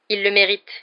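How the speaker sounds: sudden, loud verdict; abrupt. A narrator's reading. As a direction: blurt it out brusquely. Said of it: "dit-il brusquement". (brusque et fort)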